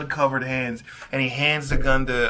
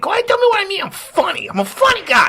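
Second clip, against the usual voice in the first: much higher, hammed-up menacing wiseguy; Joe Pesci impression; 4 dB louder, faster than usual. Tommy grilling the table, high hammed-up menace. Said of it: "You can do a better Joe Pesci".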